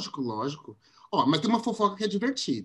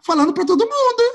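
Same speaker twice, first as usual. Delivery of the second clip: excited; 12 semitones higher, 7 dB louder, faster than usual. Retold or imitated. Friend quoting the spreading gossip.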